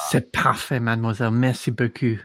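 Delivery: smooth French gentleman; French accent. Acted. Player voicing French character.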